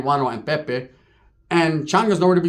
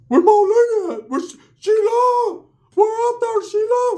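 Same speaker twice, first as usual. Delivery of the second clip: very high falsetto calling out; drawn-out, piercingly high impression; Ricky's high-pitched voice; 4 dB louder, slower than usual. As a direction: call for a lost love in squeaky falsetto. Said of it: "He has that high-pitched voice".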